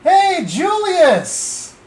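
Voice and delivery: excited greeting. Real, not acted. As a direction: call out to a new viewer, stretching it. Greeting a viewer in chat.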